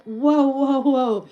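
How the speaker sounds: bright delighted wows; excited wow. Real, not acted. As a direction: cheer the new guest with a burst of wows. Excited at an Austrian viewer.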